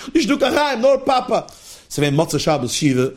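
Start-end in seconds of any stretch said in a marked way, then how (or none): 0.1-1.5 insistent drilling. Retold or imitated. Acts teacher drilling the child.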